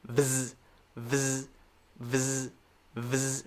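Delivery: "Vz" as one soft buzz; "vz" joined without any vowel; straight V to Z. Shown as a demonstration. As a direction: glide from v into z in "vz" with no break. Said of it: "go straight from the v to the z".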